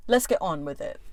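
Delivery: usual speaking voice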